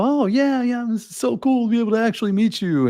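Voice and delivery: high, far-off excited gush; gushing excitement. Retold or imitated. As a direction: gush at finally meeting someone. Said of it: "and go".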